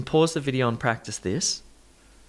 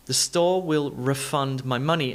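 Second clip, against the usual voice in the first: refund said re-FUND, as a verb; verb stress on second syllable. Shown as a demonstration. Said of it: "refund is the verb".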